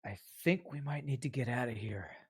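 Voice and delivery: quietly voiced warning; whisper to the group. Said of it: "whispers to everybody".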